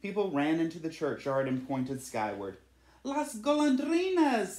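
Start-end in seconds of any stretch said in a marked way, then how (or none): 3.0-4.6 much higher and louder, slow ringing call; joyful cry. A narrator's reading. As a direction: call out to the sky in delight. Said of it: "they cried".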